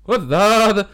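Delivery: loud announcer-style call; shouted show name. Acted. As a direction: belt out the podcast name. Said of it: "eu gosto muito do Kafalski, a dizer o nome".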